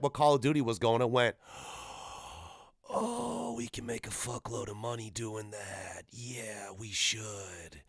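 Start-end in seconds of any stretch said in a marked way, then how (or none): 1.6-2.3 soft breathy gasp of realization; mock gasp. Acted greedy industry reaction. 2.9-7.8 hushed, very soft mock awe; scheming half-whisper. Imitates companies scheming over money.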